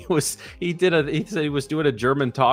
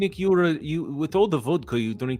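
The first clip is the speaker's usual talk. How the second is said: put-on Russian-accented English, playful; hammed-up Russian accent; mock Russian accent; 2 semitones higher than usual. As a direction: concede graciously in a thick Russian accent. Shows off his Russian accent.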